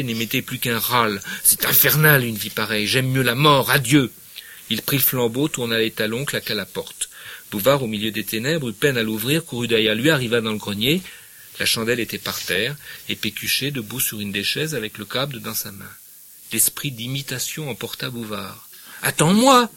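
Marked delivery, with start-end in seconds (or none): 1.4-4.1 loud, desperate cry of despair; Pécuchet declares he'd rather die, shouting in despair. Acted. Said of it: "Ils criaient à la fois". 19.0-19.7 very high, loud call; urgent cry. Acted. Bouvard follows to the noose.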